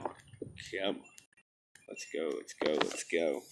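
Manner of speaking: hushed low urging under breath; whispered muttering. Real, not acted. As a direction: mutter it, willing it to load. Muttering while waiting for load.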